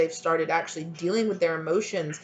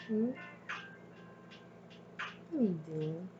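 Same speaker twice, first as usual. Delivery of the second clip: ordinary talk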